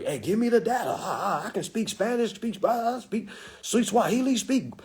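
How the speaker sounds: a brain hungry for languages; eager kid computer. Pretend.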